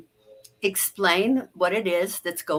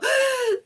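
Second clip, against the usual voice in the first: sharp, high intake of breath as if slapped; shocked gasp. Retold or imitated. Coach showing how we react to a rude remark, gasping. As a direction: gasp like you've just been slapped. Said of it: "and we go".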